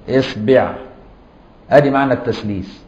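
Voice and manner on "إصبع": إصبع said esbeʕ with i on both the hamza and the b; vowel variants. Shown as a demonstration. Teacher to students, إصبع said esbeʕ. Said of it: "يعني بضم وفتح وكسر الهمزة".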